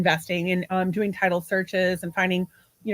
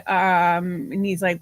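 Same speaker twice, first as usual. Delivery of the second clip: ordinary delivery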